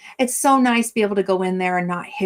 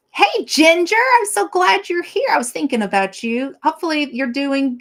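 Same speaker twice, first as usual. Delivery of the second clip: high, loud, bright hello to a friend; light up as if she just walked in; excited greeting; 9 semitones higher, 4 dB louder than usual. Real, not acted. Greeting her friend Ginger on camera, high and excited.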